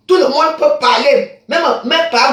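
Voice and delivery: a furious slow declaration; angry outburst. Real, not acted. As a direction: slam every word down in fury. Angry rant at rival.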